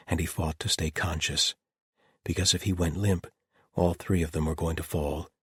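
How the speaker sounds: plain reading voice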